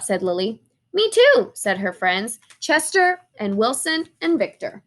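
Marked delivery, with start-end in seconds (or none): 0.9-1.5 eager chorus. Acted. Friends chime in eagerly.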